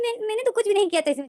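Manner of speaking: put-on high, innocent whine of a hurt child; childlike hurt voice. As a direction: sound like a scolded little boy. Lenzen childlike, protesting.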